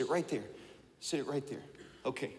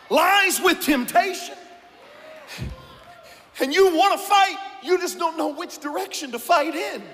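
preaching at full force; 9 semitones higher than usual. Acted. Preacher stirring the congregation, shouting. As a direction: build it up until you are shouting.

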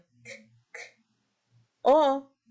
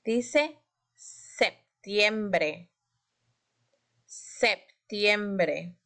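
very slow, stretched septiembre; sounding out words; much slower than usual. Shown as a demonstration. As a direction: draw out septiembre syllable by syllable. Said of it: "se las voy a leer yo".

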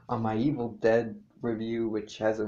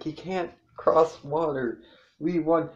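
mock triumph; 6 semitones higher, 4 dB louder than usual. Retold cheer of escaped dinosaur hunters.

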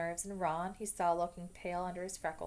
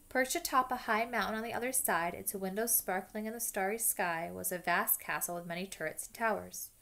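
ordinary delivery, nothing marked

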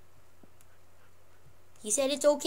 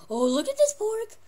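high coaxing; 11 semitones higher, 6 dB louder than usual. Real, not acted. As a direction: tempt the dog with pork. Coaxing pet with pork.

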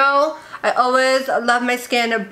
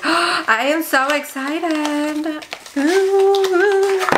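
thrilled gasp; 4 semitones higher, much slower than usual. Real, not acted. Gasps at new serum.

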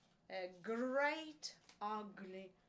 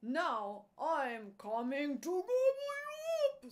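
loud, higher-pitched menacing troll; troll threat; 5 semitones higher, 8 dB louder than usual. A narrator's reading. Troll threatening the middle goat, loud menace. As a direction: roar the threat as a hungry troll. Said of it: "said the troll".

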